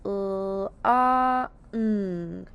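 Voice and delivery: L, A, ng as three isolated sounds, each held; sounded out. Shown as a demonstration. Teacher showing the sounds in long, sounded out. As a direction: draw out each sound of L, A, ng separately. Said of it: "L being L".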